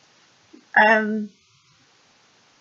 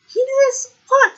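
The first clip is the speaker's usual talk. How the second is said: shocked squeak; 5 dB louder than usual. Retold or imitated. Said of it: "And she's like".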